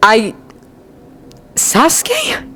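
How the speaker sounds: hesitant, broken by a long pause; tentative, rising question; uncertain. A narrator's reading. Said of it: "Naruto asks uncertainly".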